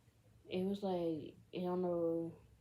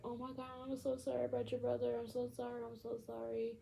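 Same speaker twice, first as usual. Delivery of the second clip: higher, hurried retold condolence; mock tearful apology; 6 semitones higher, 4 dB quieter, faster than usual. Friends to Rob about his brother, mock tearful apology. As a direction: imitate a friend's tearful sorry, lightly. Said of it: "friendly crying".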